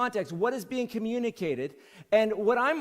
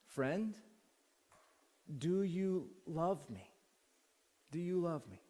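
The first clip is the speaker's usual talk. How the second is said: tender question; 4 semitones lower, 10 dB quieter, slower than usual. Pretend. Jesus's tender question, hushed.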